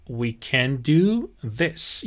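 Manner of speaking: very slow with a rising end; slow model phrase. Shown as a demonstration. Teacher offering the phrase to students, very slow. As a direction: stretch each word for learners.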